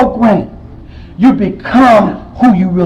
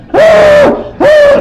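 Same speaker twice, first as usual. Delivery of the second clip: high woo cries. Real, not acted. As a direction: let out two high wails, overwhelmed with grief. Overcome at friend's funeral.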